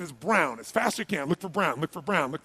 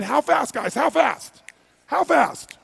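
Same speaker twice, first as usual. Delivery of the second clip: loud, higher, insistent prompt; rallying demand; 4 semitones higher, 6 dB louder, slower than usual. Real, not acted. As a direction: drive the crowd to shout back. Prodding the crowd to answer.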